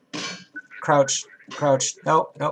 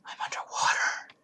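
whispering; 5 dB quieter than usual. Real, not acted. Whispered while drowning in game.

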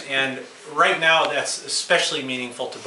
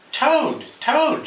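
high, eager call for a sleepy friend; Frog shouting; 11 semitones higher than usual. A narrator's reading. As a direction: call out for Toad, pitched up high. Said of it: "shouted Frog".

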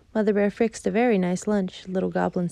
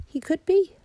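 playful mother bear; 12 semitones higher, 5 dB louder than usual. Acted. Mother Bear laughing reply.

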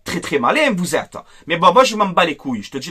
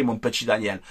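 condescending aside; 11 semitones lower, 12 dB quieter than usual. Real, not acted. Condescending jab at pundit.

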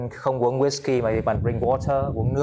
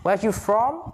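mock online teacher; 8 semitones higher than usual. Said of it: "quay lên mạng mấy cái".

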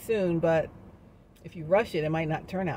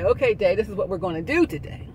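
"Okay, day" said louder and firmer, taking charge; commanding the day; 2 semitones higher, 5 dB louder than usual. Retold or imitated. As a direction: tell the day what happens. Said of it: "No, you command the day".

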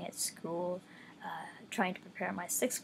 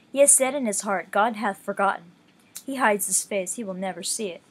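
a slightly louder, measured Bible read-along; scripture reading; 5 dB louder than usual. Acted. Reading Psalm ten aloud to listeners, measured scripture voice. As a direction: read the psalm with steady reverence.

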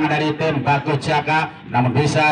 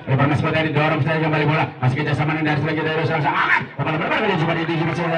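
ordinary talk, nothing marked

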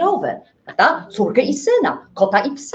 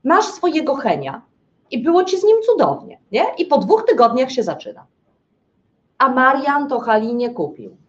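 normal talking voice

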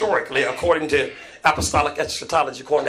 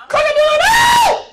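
high, slow crowing shout; rooster crow; 8 dB louder, slower than usual. Acted. Preacher crowing the rooster's call at Peter.

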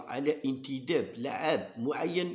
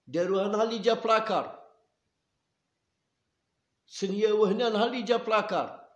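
heated accusation; 7 semitones higher, 4 dB louder than usual. Real, not acted. Accusing federation of signing away players.

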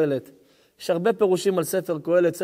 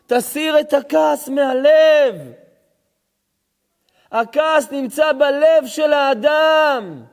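preached shout; 7 semitones higher, 6 dB louder, much slower than usual. Acted. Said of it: "shout heard while preaching".

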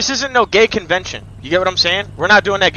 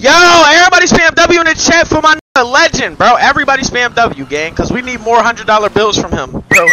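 higher, louder hype to the chat; rally the viewers; loud streamer shout-out; hype call; 6 semitones higher, 7 dB louder than usual. Real, not acted. Hyping chat for donor.

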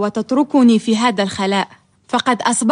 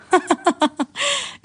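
short high rueful laugh; bitter laugh; 7 semitones higher, 5 dB quieter than usual. Acted. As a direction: laugh at your own misfortune. Laughs at their plight.

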